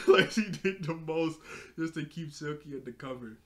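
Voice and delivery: tickled, loud and giggly; laughing while talking. Real, not acted. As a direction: burst of real laughter, then amused words. Cracking up at the scene.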